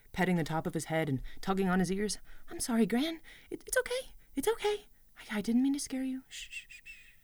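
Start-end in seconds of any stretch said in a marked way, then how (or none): 2.5-6.2 higher, softer calming voice; soothing. Acted. Girl soothing her frightened goat. 6.3-7.1 soft whispered shushing; hushing. Acted. Girl hushing her frightened goat.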